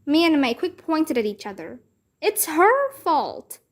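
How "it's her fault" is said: high, slow accusing shout; shouted together. A narrator's reading. Two girls blaming each other, high slow shout. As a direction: point and yell it. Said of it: "we shouted together".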